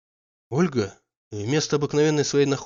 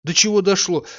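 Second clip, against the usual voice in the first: horrified; 5 semitones higher, 5 dB louder, slower than usual. A narrator's reading. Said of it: "с ужасом сказал он".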